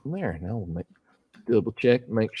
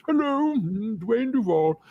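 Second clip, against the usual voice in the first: much higher, put-on genteel old gentleman voice; imitating Dwayne Duvall; 12 semitones higher, 4 dB louder than usual. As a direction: introduce yourself like a courtly old magician, then probe. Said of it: "'Cause he kind of talked like this".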